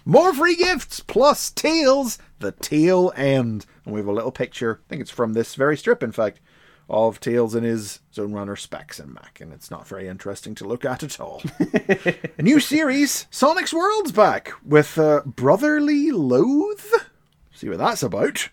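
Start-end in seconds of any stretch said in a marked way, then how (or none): 0.0-3.7 mock trailer announcer. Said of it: "next issue announcer tease". 12.4-16.8 mock trailer announcer. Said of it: "next issue announcer tease".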